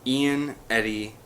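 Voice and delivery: plain reading voice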